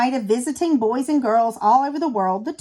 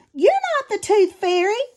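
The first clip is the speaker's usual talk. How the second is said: mild shout; 9 semitones higher than usual. A narrator's reading. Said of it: "Chomp shouted".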